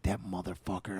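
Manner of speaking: muttered curse. Retold or imitated. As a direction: spit it under your breath. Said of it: "I'm like".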